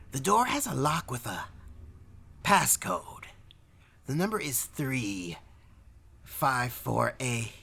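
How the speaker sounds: high, halting clerk voice; weaselly clerk. Acted. Clerk giving the passcode to visitors, high and halting.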